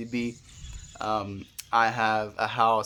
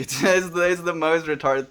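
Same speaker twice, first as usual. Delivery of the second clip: laughing at herself; 6 semitones higher, 5 dB louder than usual. Real, not acted. Laughing at own past stupidity.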